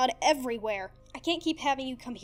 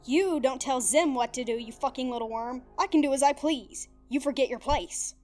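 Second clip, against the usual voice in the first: snarling; 2 semitones higher, 7 dB louder than usual. Acted. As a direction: bite back and put him in his place. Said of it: "Zim snarled".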